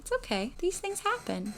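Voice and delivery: soft reassurance. Retold or imitated. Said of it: "And I'm like".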